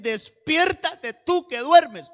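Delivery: loud, high, slow scriptural summons; rousing wake-up call. Acted. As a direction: call out to rouse the sleepers.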